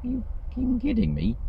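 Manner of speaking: as if you can't believe what you just read; mock outraged disbelief at the training screen; incredulous. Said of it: "And it's like".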